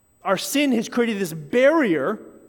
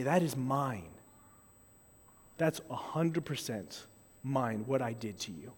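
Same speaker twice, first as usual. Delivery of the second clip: sincere confession; 7 semitones lower, 11 dB quieter than usual. Shown as a demonstration. Owning up to sin, quoted confession.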